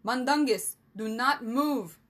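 loud, emphatic order; commanding, slowing on the last words; firm command. A narrator's reading. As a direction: order a friend to freeze. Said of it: "Rennie commanded".

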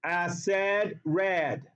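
"Acid" said as ASS-ED so it chimes with "red"; acid rhymed with red. Shown as a demonstration. Teacher insisting to students it rhymes, "Acid" ending like "red". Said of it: "Yes, it does rhyme".

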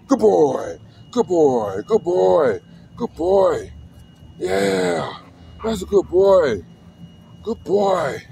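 high-pitched doggy praise; praising a dog. Real, not acted. Owner praising his dog, high excited pet talk.

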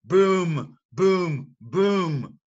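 loud, high booming thunder; thunder booms. Shown as a demonstration. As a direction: boom out like thunder. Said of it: "Mister Brown makes thunder".

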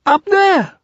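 very high, loud small-animal squeak; frightened. Acted. Piglet showing Christopher Robin the danger, high alarmed squeal.

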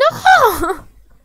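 high loud laughing shriek. Real, not acted. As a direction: burst into a screaming laugh. Laughing scream after the choice.

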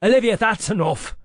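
loud, firm reprimand; stern snap. A narrator's reading. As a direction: snap it to shut her down. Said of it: "Cedric snapped".

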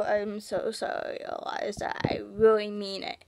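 soft unvoiced whisper of a name; whispered apology. Real, not acted. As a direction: whisper it like a guilty aside.

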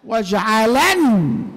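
very slow واجعلن, last syllable swelling into a held ن; sounded light nun. Shown as a demonstration. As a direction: stretch واجعلن out to its final n. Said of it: "الخفيفة". (واجعلن بنون خفيفة ممدودة)

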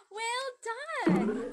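excited praise. Real, not acted. Teacher praising child in lesson.

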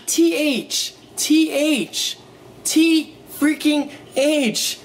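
T H spelled out high and slow, each letter hit; high spelled-out T freaking H with freaking slowed. Shown as a demonstration. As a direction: insist on every letter of T H. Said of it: "spelling out the right way".

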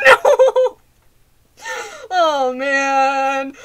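high loud shriek through laughter; panicked laughing scream. Real, not acted. Player jumps at the animatronic, shrieking with laughter. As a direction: scream it, half laughing in fright.

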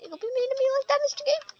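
whiny falsetto. Acted. Gamer whining up high at the game.

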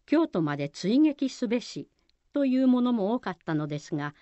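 normal talking voice

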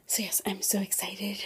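soft whisper. Real, not acted. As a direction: keep it hushed. Whispered aside.

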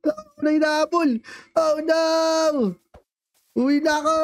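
high, playful panic while being chased; kunwaring takot. Pretend. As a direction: cry out as you flee, hammed-up. Tumatakas sa bantay sa laro. (natatarantang sigaw habang tumatakas)

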